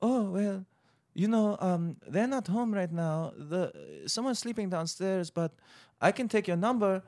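put-on gentle, hesitant African-accented woman; imitated African accent. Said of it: "African woman opens up the door".

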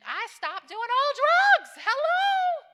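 high, loud, drawn-out holler; exuberant shout. Real, not acted. Testifying to the church that she changed, exuberant shout. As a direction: shout it out with a long, soaring "hello". Exclaims her change to the congregation.

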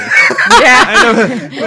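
high, loud, slow, laughing it out. Real, not acted. Joking about being high, laughing. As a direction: dissolve into laughter.

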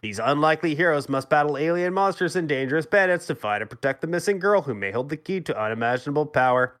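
ordinary delivery

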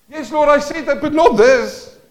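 loud, high protest to the Lord; voicing a believer arguing with God; acted protest.

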